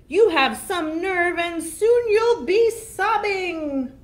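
high, loud troll threat, last words slow; menacing troll. Acted. Troll threatening the little goat, loud and menacing. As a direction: loom over the goat and gloat.

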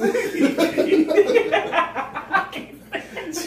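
high loud laugh. Real, not acted. Laughing at herself.

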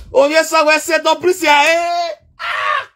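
very high shrieking cry; shrieked plea ending in a scream. Acted. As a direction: shriek it at him in mock alarm. Shrieking at Ovie son.

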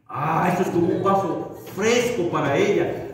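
usual speaking voice

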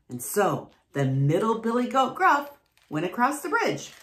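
ordinary delivery, nothing marked

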